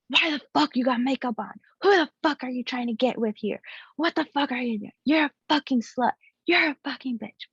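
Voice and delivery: high-pitched mock of an angry man's rant; retold angry tirade. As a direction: imitate a jealous partner hurling accusations and insults. Retold partner's furious tirade while slamming desk.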